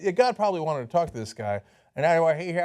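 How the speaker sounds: usual speaking voice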